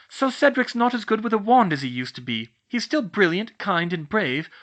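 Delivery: heated scolding. A narrator's reading. Hermione lectures Cho heatedly.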